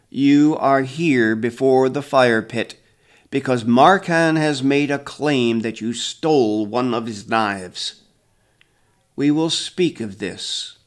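loud, slow stern judge; solemn elder intoning. A narrator's reading. Grandfather addressing the accused at the fire pit, loud and solemn. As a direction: pronounce it slowly like a village judge. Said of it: "the grandfather intones".

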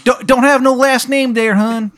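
high, loud, badly faked Maryland voice; terrible Maryland accent. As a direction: ham up a cartoonish Maryland accent. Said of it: "sounds like a bad impression of a Maryland accent".

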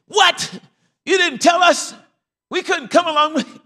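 high, loud shocked outcry; hammed-up scolding; upset church members. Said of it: "now they were upset".

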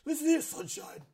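high, lightly British heel voice; mock British wrestler; British accent. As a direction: sneer it in British. Repeats British impression.

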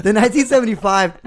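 hyped-up announcer push on "the nineteen seventy-five". Shown as a demonstration. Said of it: "Now the energy's up".

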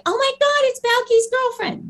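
very high-pitched fangirl squeal; imitated excited fan. Mimicking fans spotting her from the show, squealing excitement.

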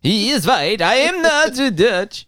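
slow, high, lightly faked Dutch accent; a joking hint of a Dutch accent; mock Dutch accent. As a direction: ham up a Dutch voice, slowly. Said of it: "A Dutch guy pretending to be a cowboy".

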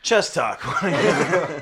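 loud, laughing show intro. Real, not acted. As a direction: belt out the show name with a laugh. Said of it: "You're talking too quietly".

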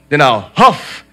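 the wolf threatens to blow the house in; big bad wolf.

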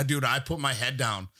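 normal talking voice

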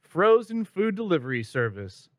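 drawn-out tagline read; slow ad announcer. Acted. Spoof ad tagline.

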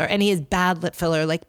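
ordinary talk, nothing marked

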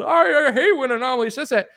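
high whiny mock of a supporter; mocking whiner. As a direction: whine it like an offended fan.

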